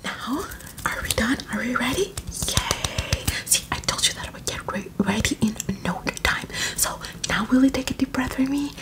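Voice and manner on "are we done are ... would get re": ordinary delivery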